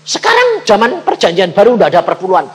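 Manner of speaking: high, loud, fast scoffing dad; hammed-up father mocking tithing, high and loud; dismissive father. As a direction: brush off the son with a scoff. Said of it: "Papa bilang apa?".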